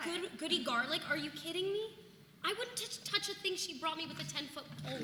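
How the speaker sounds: new mother scoffing at a neighbour's remedy, indignant and high; indignant outburst. Acted. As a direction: pitch jumps high in scornful disbelief. Outraged at neighbour's suggestion.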